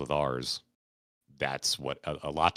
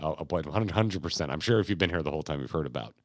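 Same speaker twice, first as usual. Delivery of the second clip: usual speaking voice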